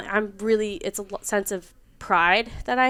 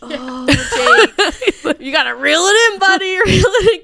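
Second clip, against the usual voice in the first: high, loud plea breaking into laughter; amused HR telling-off, pitched up; exasperated mock warning; 10 semitones higher, 8 dB louder, slower than usual. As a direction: rein a buddy in, laughing as you go. Retold HR warning to over-fond coworker.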